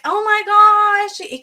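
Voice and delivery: high, loud mock squeal; excited gasp. Retelling the wife's reaction, an excited squeal. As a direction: squeal it like big news. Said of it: "she's like".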